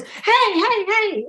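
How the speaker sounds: high, eager calls of hey, retold with a grin; an imitated trucker hollering across the parking lot; excited calling out. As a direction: call her over like an excited fan. Delivery guy calling out to her.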